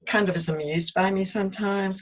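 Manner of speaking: ordinary delivery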